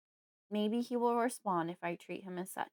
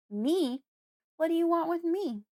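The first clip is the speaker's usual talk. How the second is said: high, louder, alarmed; panicking; 9 semitones higher, 5 dB louder than usual. A narrator's reading. Frightened girl scrambling away from Fred, panicking. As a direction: recoil in panic. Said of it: "I'm panicking now".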